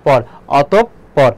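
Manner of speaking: অতঃপর as 'otop... por', doubled p; very slow অতঃপর, halting on the first p; থেমে ভেঙে উচ্চারণ. Shown as a demonstration. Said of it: "অতপ, এই জন্য থেমেছে". (অতঃপর ধীরে 'অতোপ্-পর')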